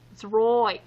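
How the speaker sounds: light mock Australian "It's right" with right as "roight"; a hint of an Aussie twang; playful touch of Australian accent. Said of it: "Australia, mate".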